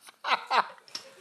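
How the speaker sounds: real high, loud burst of laughter; hearty laugh. Real, not acted. Teacher after teasing laughers who don't know why, laughing out loud.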